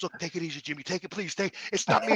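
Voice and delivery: calm him down, almost breathless; imitating the nervous clerk. Sweating clerk begging Jimmy to calm down, hushed.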